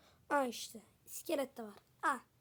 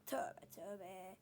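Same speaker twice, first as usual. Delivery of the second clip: hushed unvoiced aside; sheepish whisper after a near swear word; 6 semitones lower, 15 dB quieter than usual. Real, not acted. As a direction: whisper it as if taking it back. Whispered self-correction after slip.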